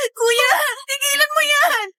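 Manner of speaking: sobbing, yelling at brother to stop; crying shout. Acted. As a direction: cry out through tears. (umiiyak na sumisigaw)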